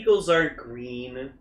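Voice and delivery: low, soft deadpan; matter-of-fact. Real, not acted. Podcaster objecting to Green Eagle, matter-of-fact deadpan. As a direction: state it dryly as simple fact. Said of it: "how matter of factly you say".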